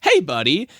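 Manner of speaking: casual villain greeting; cocky Homelander greeting. Retold or imitated. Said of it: "Homelander will just fly in and go".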